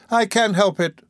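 high, plaintive whimper. A narrator's reading. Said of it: "whimpered the lady".

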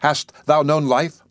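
plain reading voice

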